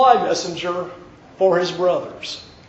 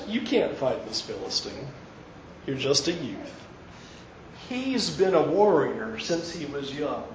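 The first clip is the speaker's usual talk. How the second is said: much softer, lower, kindly warning; king softly warning the boy; gentle doubt; 6 semitones lower, 10 dB quieter than usual. Acted. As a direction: talk him down gently. Saul gently doubts David.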